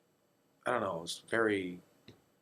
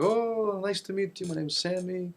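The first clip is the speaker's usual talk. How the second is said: put-on dad's accented greeting; 11 semitones higher, 3 dB louder than usual. Dad meets the girl's mom, gushing in accent. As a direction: play dad greeting her in a high accented voice. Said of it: "It's your accent".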